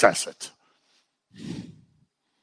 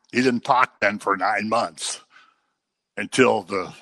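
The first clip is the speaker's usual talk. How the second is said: normal talking voice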